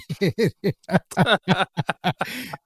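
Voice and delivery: long, high cackle in quick bursts; helpless high-pitched laugh. Real, not acted. Host reading a joke about heat and humidity, laughing.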